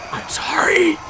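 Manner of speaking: sheepish whisper, all breath, no voice; whispered apology. Real, not acted. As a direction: whisper sorry like you're embarrassed. Apologizing for the bad impression.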